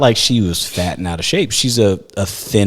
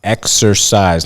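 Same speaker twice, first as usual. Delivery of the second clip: drawn out slowly; much slower than usual. Retold or imitated. Dumbing down the word exercise.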